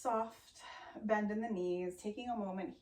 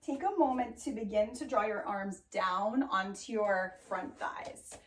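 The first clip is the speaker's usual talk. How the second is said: ordinary talk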